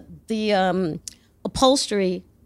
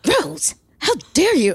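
pitched up in mock outrage, about seven semitones higher; mock offended friend; 7 semitones higher than usual. Offended friend scolding Rose, higher mock outrage. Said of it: "And she's like".

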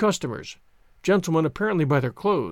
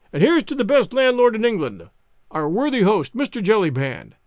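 louder, raised toasting voice; 4 semitones higher, 4 dB louder than usual. Acted. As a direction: call the toast out to the room. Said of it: "in a loud tone of voice".

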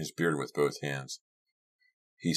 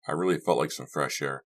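ordinary delivery

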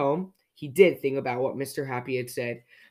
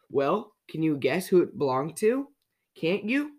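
usual speaking voice

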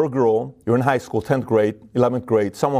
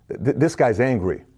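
ordinary delivery

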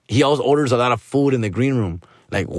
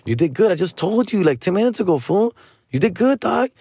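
rising higher, fed up; higher and higher in annoyed disbelief; exasperated; 7 semitones higher than usual. Retold or imitated. Comedian snapping back at nagging friend, pitch climbing.

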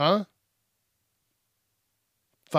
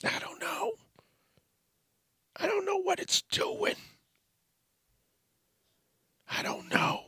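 much higher, softer creaky creature voice, ending in a whisper; Gollum voice; 10 dB quieter than usual. Acted. Musing about the news, drifting into a softer, higher Gollum voice. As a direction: slip into a whispery Gollum hiss. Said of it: "I don't know why I sounded like Gollum".

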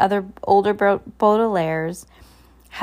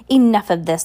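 louder, cutting in impatiently; 2 semitones higher, 7 dB louder than usual. A narrator's reading. Hook-handed man ends the stalling, impatiently. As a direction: put a stop to it, loud. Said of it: "stepped forward impatiently".

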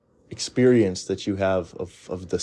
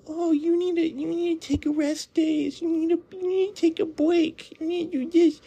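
mock feminine voice. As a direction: parody a fussy caring woman. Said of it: "very feminine advice".

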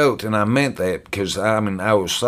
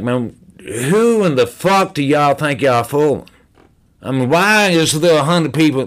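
higher-pitched, indignant challenge; worked-up outburst; 5 semitones higher, 3 dB louder than usual. Retold or imitated. Newcomer challenging a room full of people at a meeting, worked up. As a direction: retell it as an exasperated rant. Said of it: "I may end up getting loud".

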